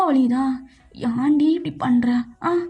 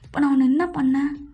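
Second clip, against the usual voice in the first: ordinary delivery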